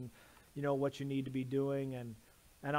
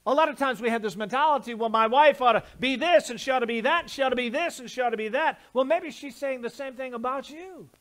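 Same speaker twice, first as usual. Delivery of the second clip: loud, rising preacher's cry, slowed on key words; preaching at full force; 8 semitones higher, 10 dB louder, faster than usual. Acted. Pastor preaching to husbands at full force. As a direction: build the sermon to a shouting climax.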